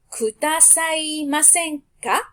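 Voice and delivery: kudasaimasenka drawn out very slowly, syllable by syllable; teacher dictates kudasaimasenka slowly; slow model word. Shown as a demonstration. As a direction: stretch kudasaimasenka so every syllable is heard. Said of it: "verb te form plus".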